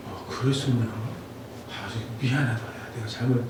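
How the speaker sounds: very soft, low apologetic murmur; acted teacher softly admitting a mistake; gentle apology. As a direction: say sorry under your breath, low and hushed.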